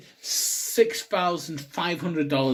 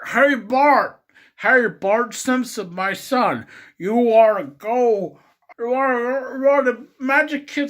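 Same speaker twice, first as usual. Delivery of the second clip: loud, high cartoon dad impression; slow, booming put-on oafish father; Homer Simpson; 9 semitones higher, 8 dB louder than usual. Homer offering his son Bart something at the gift shop, loud cartoon voice. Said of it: "Homer Simpson".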